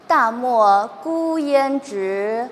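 loud, slow declaimed verse; student recitation attempt. Shown as a demonstration. Student trying the line for the teacher, loud slow recitation. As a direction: declaim loudly, stretching out each line. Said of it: "你试一下".

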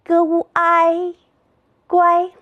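"g u i 乖" chanted high and level, g, ū, āi, then guāi; three-part blend "g u i 乖", all first tone; pinyin blending. Shown as a demonstration. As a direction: sound out "g u i", then join as "乖" on one high note. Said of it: "自己读读".

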